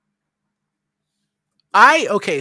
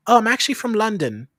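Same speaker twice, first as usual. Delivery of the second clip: higher, louder posh British; put-on posh British accent; 8 semitones higher, 5 dB louder than usual. Customer telling server he's from London, posh British accent. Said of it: "this is how I talk every time".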